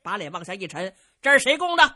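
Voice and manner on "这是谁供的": loud, stern demand; angry demand. Acted. Xu Maogong furious at the shrine, demanding loudly. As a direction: bark out who did it. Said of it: "徐茂公气坏了".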